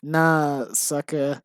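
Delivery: slow mock gangster, drawn-out words; hammed-up gangster, word by word. Writer reading out own embarrassing script, mock gangster. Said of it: "stereotype of how gangsters talk".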